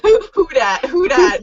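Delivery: lightly voiced Louisiana Cajun "who dat"; Cajun accent. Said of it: "stereotypical Cajun back swamp Louisiana".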